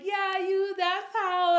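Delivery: high, loud mock holler; whooped congratulations, acted out; cheering onlooker. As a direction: imitate someone cheering from down the block. Guard cheering from down the block.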